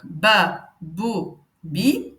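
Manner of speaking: "ba", "bou", "bi" each said slowly and clearly, one by one; model syllables. Shown as a demonstration. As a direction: sound out "ba", "bou", "bi" for learners to repeat. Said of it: "je vais venir prononcer chaque lettre".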